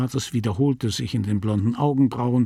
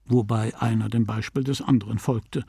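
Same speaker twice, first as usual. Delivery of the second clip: plain reading voice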